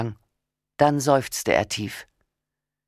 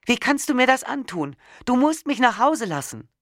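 much higher, desperate anger; furious plea; 12 semitones higher than usual. A narrator's reading. Wife pleading with husband to let her go home, furious. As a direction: beg and rage at once, pitched high. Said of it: "konnte ich meine Wut nicht im Zaum halten".

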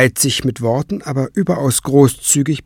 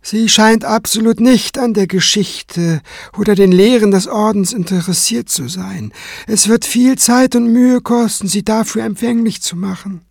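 higher-pitched, measured character voice; composed cleric; 10 semitones higher than usual. Acted. Kranz reporting to Tenebrae on his pupil, composed. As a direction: report evenly, unruffled.

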